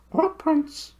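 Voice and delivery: very high hooting owl voice, far from the narrator's own; high, hooting bird voice. Acted. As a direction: hoot it like a big owl.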